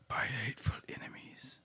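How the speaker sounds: quick eerie whisper; gravelly whisper. Acted. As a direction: hiss it under the breath. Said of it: "a little quiet and a little gravelly".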